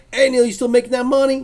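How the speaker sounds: loud, high cheerful hail; calling out. Retold or imitated. Fan greets Neil at the next show, loud cheerful call. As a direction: shout a friendly greeting across the room.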